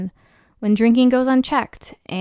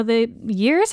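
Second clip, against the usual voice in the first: ordinary talk, nothing marked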